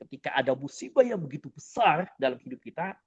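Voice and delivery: ordinary delivery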